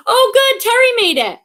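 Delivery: light up as a friend turns up; delighted greeting. Real, not acted. Delighted a regular viewer has arrived.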